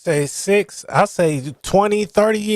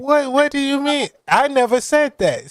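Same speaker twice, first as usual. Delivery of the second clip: higher-pitched, acted protest of innocence; indignant mock denial; 7 semitones higher than usual. Retelling a rapper's denial to the panel, mock indignant. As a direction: play someone denying it in disbelief.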